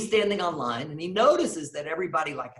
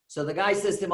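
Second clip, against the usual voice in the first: ordinary talk, nothing marked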